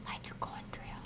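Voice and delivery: breathy self-correction under the breath; whispered aside. Shown as a demonstration. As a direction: whisper "Green is for mitochondria" to yourself. Correcting self, repeating aloud.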